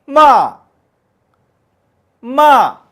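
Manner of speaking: 마 dropping sharply from high, loud; fourth tone. Shown as a demonstration. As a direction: snap 마 down from the top like a shout. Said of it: "솔부터 밑에 도까지 쭉 내려오면서".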